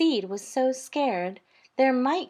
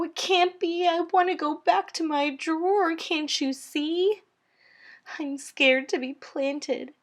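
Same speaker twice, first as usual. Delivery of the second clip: high, panicky pleading seed voice; a tiny seed begging not to be planted; higher, urgent cries of dread; frightened little seed; 7 semitones higher, 4 dB louder than usual. A narrator's reading. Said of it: "He saw to his horror".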